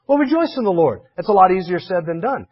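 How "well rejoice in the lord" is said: glib cheer. Retold or imitated. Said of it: "diagnosed with terminal cancer".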